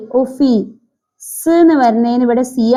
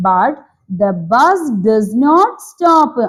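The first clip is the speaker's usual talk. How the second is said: put-on very slow, word-by-word reading for children; deliberate storybook reading; story reading; much slower than usual. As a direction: read it out slowly, every word clear. Reads story text slowly.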